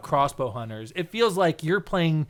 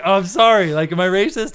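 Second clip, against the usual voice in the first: mortified apology; 4 semitones higher, 7 dB louder than usual. Retold or imitated.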